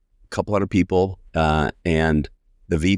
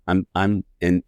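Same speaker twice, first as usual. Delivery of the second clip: normal talking voice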